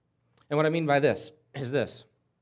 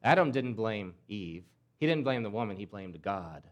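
usual speaking voice

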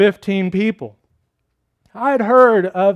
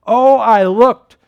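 loud, slow, raised exclamation; fervent recollection; 5 semitones higher, 7 dB louder, slower than usual. Retold or imitated. Convert telling how he looked at Christ, slow and fervent. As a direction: draw it out with feeling. Convert recalls his moment of looking.

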